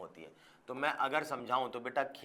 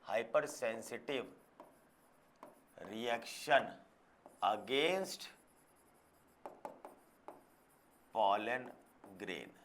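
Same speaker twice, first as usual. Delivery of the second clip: slow dictation; 3 semitones lower, 5 dB quieter, much slower than usual. Shown as a demonstration. Teacher dictating a definition slowly.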